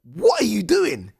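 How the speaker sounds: very high, loud, slow yell at the referee; outraged shout. Retold or imitated. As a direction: scream it in disbelief at the ref. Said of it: "I'm like".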